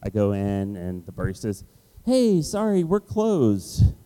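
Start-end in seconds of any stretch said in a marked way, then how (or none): 2.0-3.9 high, bright and apologetic; sorry-not-sorry café worker; mock barista voice. Barista telling him the café is closed, in a high, apologetic voice.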